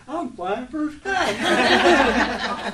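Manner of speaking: very slow, high, loud and laughing; gleeful. Retold or imitated. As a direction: savour every word like a giddy kid. Wife's joy, quoted.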